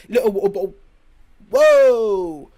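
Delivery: very loud exaggerated accent, T's dropped; mock glottal-stop accent. Mocking accents that drop letters, loud garbled accent. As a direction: blurt a mangled accent version, swallowing the consonants. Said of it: "What are you saying?".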